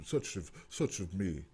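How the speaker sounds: pretending to be politely appalled instead of pissed; mock prim outrage. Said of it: "I can say appalled".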